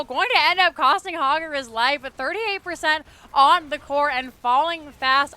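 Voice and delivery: loud, driving match commentary; live esports play-by-play. Real, not acted. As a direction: call the final push with force, stretching out the last words.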